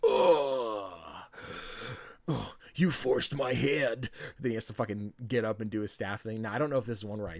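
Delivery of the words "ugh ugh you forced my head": slow, grunting put-on boss voice; fish boss voice. Replaying a boss cutscene for listeners, slow grunting voice. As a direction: drag out the defeated boss's groans. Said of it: "He goes".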